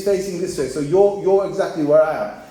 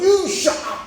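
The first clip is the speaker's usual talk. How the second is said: high-pitched mock outburst; mock angry shout; 11 semitones higher, 3 dB louder than usual. Stranger turns round from ordering, mock angry shout. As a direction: imitate a stranger suddenly yelling, pitched high. Stranger snaps at him again, retold.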